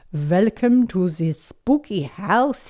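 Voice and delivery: slow vampire welcome; hammed-up vampire. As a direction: welcome guests as a theatrical vampire. Grandpa greeting in vampire costume voice.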